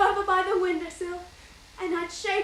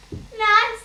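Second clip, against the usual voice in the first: calling out; 4 semitones higher, 7 dB louder than usual. Retold or imitated. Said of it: "they used to holler".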